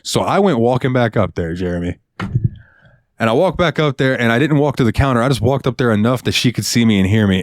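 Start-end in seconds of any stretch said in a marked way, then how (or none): none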